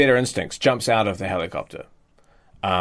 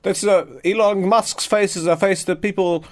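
lightly voiced, higher self-praise; smug Elon Musk; 9 semitones higher than usual. Elon Musk boasting to the pilots, lightly voiced and higher. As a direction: brag about your own trustworthy face.

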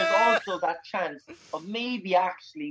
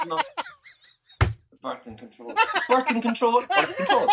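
ordinary talk, nothing marked

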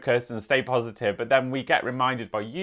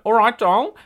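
higher, louder put-on Australian accent; mock-Australian, pitched up and punchy; [name]' best accent; 8 semitones higher, 6 dB louder than usual. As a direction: greet them in a playful Australian accent. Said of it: "in [name]' best accent".

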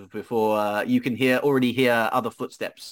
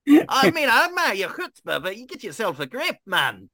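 ranting dwarf; 11 semitones higher than usual. Acted. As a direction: tear into the newcomer as a fed-up dwarf. Dwarf continues his rant.

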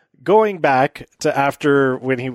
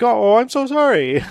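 high, mock-sorry whimper; fake sad sorry; 9 semitones higher than usual. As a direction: pretend to pity her, pitched way up. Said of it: "she put on her sad face".

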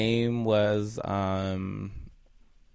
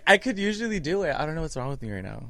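ordinary talk, nothing marked

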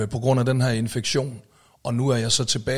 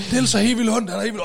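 high, urgent relayed alarm; mild imitation of a worried bandmate; panicked retelling; 10 semitones higher, 4 dB louder, faster than usual. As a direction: blurt it out high and breathless.

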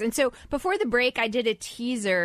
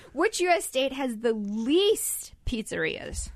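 normal talking voice

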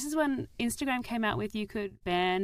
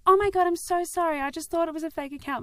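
mock apologetic fan; 10 semitones higher, 4 dB louder, faster than usual.

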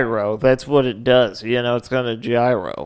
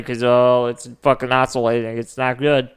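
usual speaking voice

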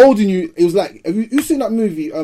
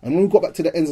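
ordinary talk, nothing marked

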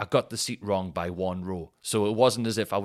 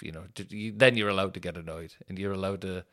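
ordinary talk, nothing marked

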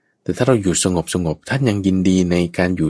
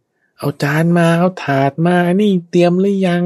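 high, drawn-out bossy calling; mock piercing holler; 11 semitones higher, 4 dB louder than usual. Monk mimicking noisy helper demanding plates and trays, high drawn-out holler. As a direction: imitate someone calling orders across a temple, pitched way up. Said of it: "เสียงแปดหลอดขึ้นเนี่ย".

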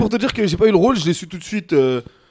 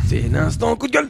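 pitched-up segment announcement; radio jingle; 3 semitones higher than usual. Pretend. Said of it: "Jingle, jingle, jingle". (voix de jingle)

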